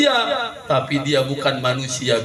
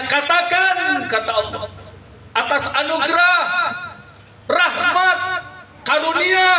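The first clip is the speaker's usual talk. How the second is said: higher and rising with each word, emphatic; fervent proclamation; 13 semitones higher than usual. Acted. Preacher rousing the mothers with God's words, high and fervent.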